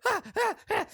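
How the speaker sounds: very high mock panic cries; freaked-out yelps. Cartoon raccoon freaking out at the film, high panicked yelps. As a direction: yelp in short high bursts. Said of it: "he's like".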